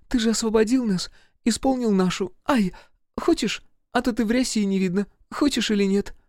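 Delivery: put-on high girlish voice with little yelps; coaxing girl. A narrator's reading. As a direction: coax him in a high, eager voice. Same girl coaxes Seryozha, yelping. (высоким голоском уговаривать)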